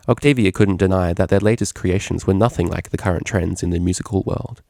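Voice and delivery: usual speaking voice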